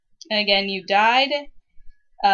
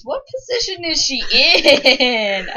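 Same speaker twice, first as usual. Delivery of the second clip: slow, loud, laughing question; loud, slow, cracking up; laughing disbelief; 7 semitones higher, 9 dB louder than usual. Real, not acted. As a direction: ask through real laughter. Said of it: "Oh my god".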